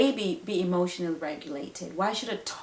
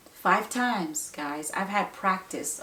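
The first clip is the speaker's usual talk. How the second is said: ordinary talk, nothing marked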